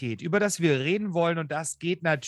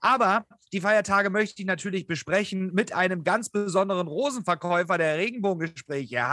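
usual speaking voice

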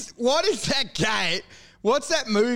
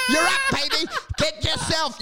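very high, exultant squeal; ecstatic salesman; 9 semitones higher than usual. Acted. Broker telling client they're up, ecstatic. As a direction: celebrate the client's windfall.

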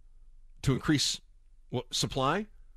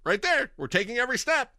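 mock proud; 9 semitones higher than usual. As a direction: show off the paper like it proves something. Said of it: "And they go".

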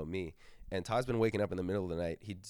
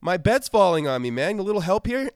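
higher, louder desperate pleading; panicked sleep-talk; 8 semitones higher, 10 dB louder than usual. Retold or imitated. Todd begs the narrator to help hold the bed, loud high panic. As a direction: beg for help with rising alarm. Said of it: "He's like".

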